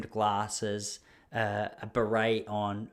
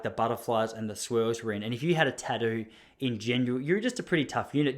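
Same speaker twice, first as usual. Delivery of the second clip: normal talking voice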